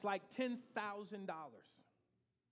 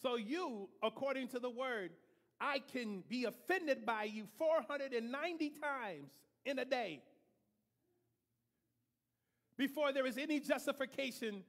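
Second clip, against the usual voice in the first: louder, driving sermon delivery; preaching at full force; 5 dB louder than usual. Real, not acted. Preacher to congregation, rising forceful preaching. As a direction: press the point on the congregation.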